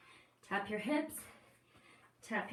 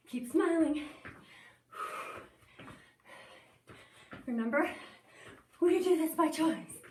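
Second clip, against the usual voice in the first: pumped coach shout; 4 semitones higher, 3 dB louder than usual. Real, not acted. Coach pushing class hard.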